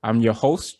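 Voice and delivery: ordinary delivery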